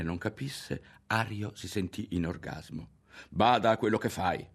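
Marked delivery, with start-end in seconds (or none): 3.3-4.5 stern, raised warning; higher-pitched threat of a boy; admonishing. A narrator's reading. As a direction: warn him off firmly. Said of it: "lo ammonì Berto".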